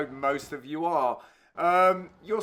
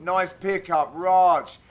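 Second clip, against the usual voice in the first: ordinary delivery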